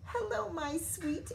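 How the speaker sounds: high, sweet-talking to her pumpkin; tender girl frog. A narrator's reading. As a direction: coo it to a beloved pumpkin.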